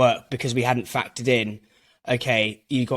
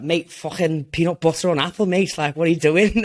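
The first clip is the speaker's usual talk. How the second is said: incredulous Scouse shop guy, pitched higher and louder; Scouse accent; 4 semitones higher, 3 dB louder than usual. Retold or imitated. Scouse buyer scoffing at the salesman's peanut butter, in a Scouse accent. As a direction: do a gobsmacked Liverpool accent, mocking the pitch. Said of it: "he was, like, some Scouse guy".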